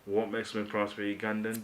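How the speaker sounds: ordinary talk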